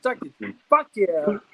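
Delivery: hammed-up insult repeated; imitating a cousin's rant; mock angry swearing. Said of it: "and I'm like".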